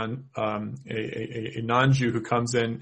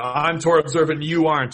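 smug; 4 semitones higher, 6 dB louder than usual. Retold or imitated. Mocking a proud hypocrite.

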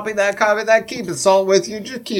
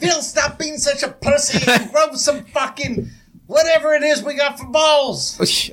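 exasperated scolding; 7 semitones higher than usual. Acted. Character scolds cowardly ghost.

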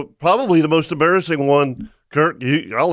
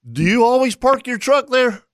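much higher, mock-innocent question; teasing question; 9 semitones higher than usual. Fishing buddy teasing the captain about his truck: sly mock-innocent question. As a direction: needle him with a sly question.

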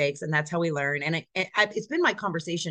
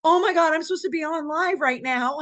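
high, loud alarm; panicked realization; 9 semitones higher, 4 dB louder than usual. Retold or imitated. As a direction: suddenly realize you should be elsewhere. Realizes she missed a live webinar.

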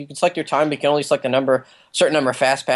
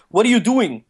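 put-on quick, higher-pitched slip into British accent; imitating John Boyega; 6 semitones higher than usual. Showing friends the actor's slip, lightly mimicked British accent. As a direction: let a hint of British accent break through. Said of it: "his British accent, like, breaks through".

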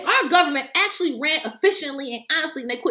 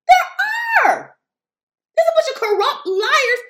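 high, loud, drawn-out insistence; emphatic shout; 11 semitones higher, 4 dB louder, slower than usual. Real, not acted. Insisting her own party has liars, shouted. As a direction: shout it way up high to drive it home.

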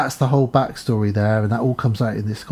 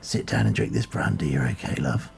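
put-on much lower, softer old doctor voice; 12 semitones lower, 7 dB quieter than usual. Doctor calming the girl with brandy, low and soft. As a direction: soothe her like a creepy old GP. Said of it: "He says".

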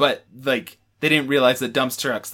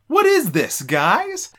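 pitched-up bewildered question; high mock bewildered voice; 11 semitones higher, 3 dB louder, much slower than usual. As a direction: squeak it out, baffled.

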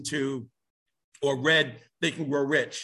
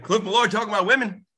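1 imitating someone teasing; 6 semitones higher, 4 dB louder, faster than usual. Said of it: "He said".

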